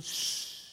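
long unvoiced shushing; hushing shh. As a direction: shush them to keep it secret. Acting out Jesus hushing disciples.

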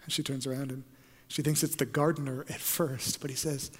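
ordinary delivery

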